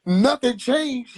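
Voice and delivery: ordinary delivery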